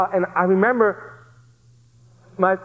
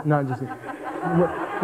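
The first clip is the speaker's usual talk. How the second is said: laughing aside; 4 semitones lower, 6 dB quieter, much slower than usual. Real, not acted. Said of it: "what time?".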